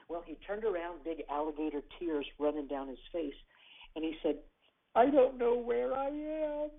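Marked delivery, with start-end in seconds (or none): 5.0-6.7 put-on high, slow, louder, upset child's voice; boy lost by the roadside, telling the stranger he's lost; tearful little boy. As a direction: plead like a lost, crying six-year-old.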